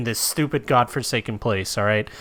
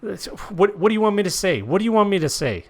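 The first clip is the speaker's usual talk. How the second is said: panicked pleading; 9 semitones higher than usual. Acted. Cornered dealer under threat.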